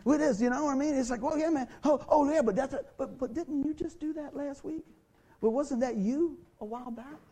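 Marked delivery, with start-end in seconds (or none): 1.2-7.2 mock defensive retort. Quoting someone dodging grace.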